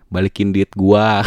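mock-indignant loud demand. Host reads Sylvie's message to an ex, loudly demanding. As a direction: belt it out as a demand.

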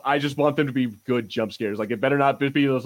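ordinary talk

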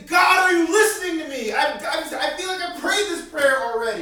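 loud, pleading cry up to God; high, loud plea; desperate prayer shout. Acted. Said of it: "go to God and say".

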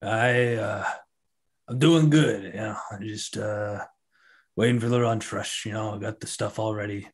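a hint of a sleepy ogre cook waiting on the lunch rush; ogre cook Leo. Cook to the adventurers, lightly voiced drowsy ogre.

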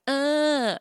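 high wordless grunt, arms up; imitating a toddler's grunt for attention; a mock toddler's wordless plea. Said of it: "she used to go like".

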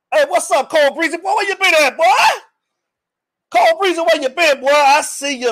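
excited calling out. Real, not acted. Host hailing a long-missed viewer, excited calling out. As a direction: call out high and excited.

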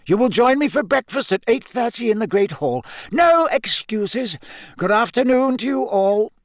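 put-on high-pitched, emphatic old headmaster; firm headmaster. Headmaster reassuring his gamekeeper, firm and insistent. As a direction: press the point as headmaster. Narrator voices Dumbledore.

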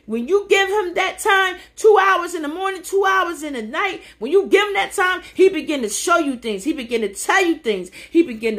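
rising to a shouted, high-pitched climax; excited preaching. Real, not acted. Preacher to online viewers, building to an excited shout. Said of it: "I get excited".

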